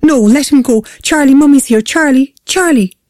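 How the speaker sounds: high, desperate mother's cries; frantic pleading. A narrator's reading. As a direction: cry out to your child before the line dies. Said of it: "Julia gasped".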